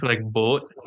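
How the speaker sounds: boat with a long deep rounded O; deep held O. Shown as a demonstration. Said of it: "it gets real deep".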